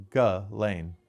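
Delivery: Ghislaine in two parts, "guh... lane"; hard G, silent S. Shown as a demonstration. Insisting on what he heard, "guh-lane" pronunciation. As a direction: separate the syllables of Ghislaine, no S. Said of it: "I heard it pronounced".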